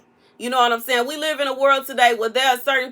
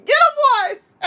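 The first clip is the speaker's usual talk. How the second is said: high call; 5 dB louder than usual. Pretend. Siccing alligators, second take.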